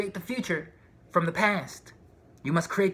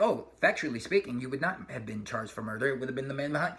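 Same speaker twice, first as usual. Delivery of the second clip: dry pedantic voice; 5 semitones lower, 4 dB quieter than usual. Retold or imitated.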